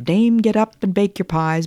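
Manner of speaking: lifted, crowing rooster cry; rooster call. Acted. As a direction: crow it out like a cock at dawn. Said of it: "the cocks cried out".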